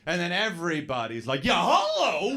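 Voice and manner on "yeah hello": high, loud, outraged hello; mock an annoyed cyclist yelling; indignant yell. Cyclist to him in the bike path, indignant.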